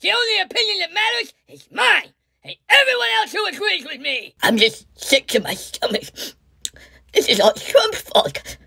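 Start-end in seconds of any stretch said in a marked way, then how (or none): 0.0-4.3 much higher, sharp berating; scolding rant. Acted. Pelosi scolding the reporter, a high angry rant. 7.1-8.6 whispered mock sob. Fake crying whisper.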